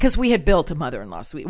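usual speaking voice

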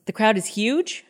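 "The crowd is huge?" echoed back with a rising, questioning end; mimicking Amber's disbelief. Host retelling the girlfriend's reply, mock incredulous echo. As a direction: echo his words back as a doubtful question. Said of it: "Amber goes".

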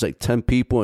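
ordinary talk

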